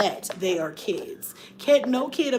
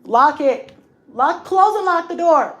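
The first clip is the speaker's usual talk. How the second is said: calling out; 5 semitones higher, 5 dB louder than usual. Real, not acted. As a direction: call across the house. Calling to kids off camera.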